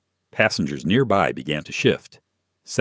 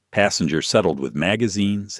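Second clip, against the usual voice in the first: usual speaking voice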